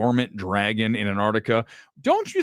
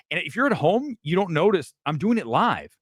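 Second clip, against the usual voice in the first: ordinary talk, nothing marked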